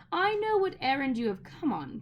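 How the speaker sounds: knowing and sly; witch voice. A narrator's reading. Witch telling Diggory she knows his errand, sly.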